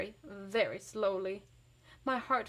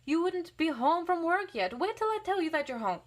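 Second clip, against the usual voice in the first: put-on much higher, louder bossy child; bossy boy; 8 semitones higher, 5 dB louder than usual. A narrator's reading. Steve bossing Else Marie in play, higher and loud. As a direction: order the other kid about. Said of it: "he yells".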